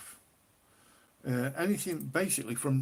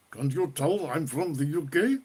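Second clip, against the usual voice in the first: louder, hammed-up British accent with a rising end; exaggerated British accent; 3 semitones higher, 5 dB louder than usual. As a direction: ham up a British accent, loudly, lifting the end. Repeats his origin in put-on voices.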